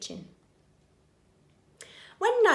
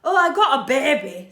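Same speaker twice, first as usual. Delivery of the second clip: proud burglar; 4 semitones higher, 11 dB louder than usual. Acted. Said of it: "says Burglar Bill".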